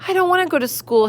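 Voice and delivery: high pleading little raccoon; whiny child. Acted. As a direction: beg like a whining kid. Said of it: "stood at the edge of the forest and cried".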